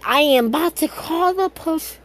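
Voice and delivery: exasperated threat. Retold or imitated. Deku threatening to call police on Ida, shrill and indignant. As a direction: play it as a fed-up, high-pitched kid.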